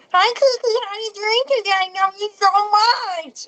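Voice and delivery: put-on sweet high baby talk to a pet. Shown as a demonstration. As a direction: coo at the cat like a tiny child. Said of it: "that's the baby voice that I use with the dolphins and my cats".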